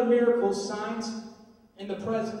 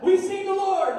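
higher and louder, joyful; excited; 8 semitones higher, 8 dB louder than usual. Retold or imitated. Disciples telling others, excited joyful announcement. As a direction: announce it with joy. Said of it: "They're all excited".

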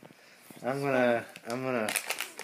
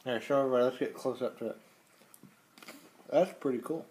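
ordinary talk

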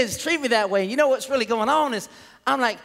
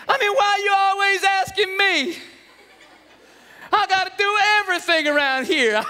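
whining son; 6 semitones higher than usual. Acted. Son protesting to dad about trash, whining. Son's whining reply to chore.

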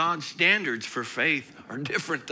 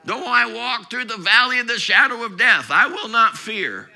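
loud, high, fervent sermon; full-force preaching; 5 semitones higher, 10 dB louder than usual. Acted. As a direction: preach it to the rafters.

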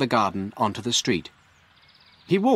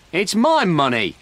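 loud, higher, drawn-out protest; protesting shout; 7 semitones higher, 5 dB louder than usual. A narrator's reading. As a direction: shout your claim to the money. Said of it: "shouted Frank".

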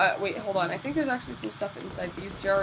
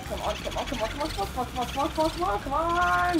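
rapid-fire chant climbing in pitch; urgent repeated urging; 5 semitones higher than usual. Real, not acted. Player straining to catch a boo, building urgent chant.

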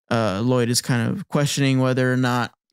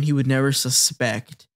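ordinary talk

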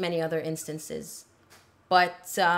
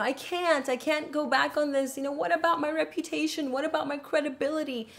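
higher whiny mock of Amber pleading; 8 semitones higher than usual. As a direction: lightly imitate a tearful, self-pitying plea. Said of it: "she's crying and going".

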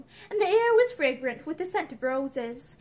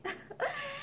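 soft, high nervous laugh; light, nervous little titter; 12 semitones higher, 11 dB quieter than usual. A narrator's reading. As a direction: let out a small uneasy laugh. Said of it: "She laughed nervously".

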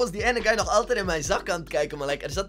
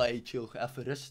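quiet inner thought; 5 semitones lower, 12 dB quieter than usual. Retold or imitated. Inner thought, calming himself.

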